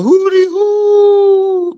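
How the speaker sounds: high, slow drawn-out whoop; hootie hoo call. Retold or imitated. Streamer echoing Angel's hootie hoo call, high slow whoop. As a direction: call it out like a hoot.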